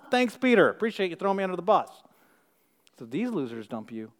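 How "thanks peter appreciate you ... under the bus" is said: mock gratitude, pitched high; high, quick sarcastic aside; sarcastic thanks. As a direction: thank him sarcastically, as the one betrayed. Voices disciples insulted by Peter.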